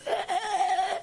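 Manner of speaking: high, strained retching sound, half laughing; imitating a friend gagging; mock retch. Friend vomiting as he is prayed over, mock retch.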